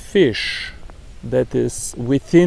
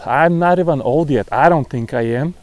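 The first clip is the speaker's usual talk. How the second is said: ordinary delivery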